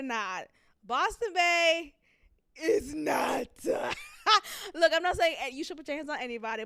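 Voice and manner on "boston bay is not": loud, high laughing cry; laughing shout. Real, not acted. Host laughing, loud about Boston Bay.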